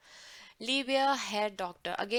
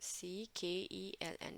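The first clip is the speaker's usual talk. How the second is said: whispered spelling; 3 semitones lower, 10 dB quieter, much slower than usual. Real, not acted. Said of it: "spelling the word under her breath".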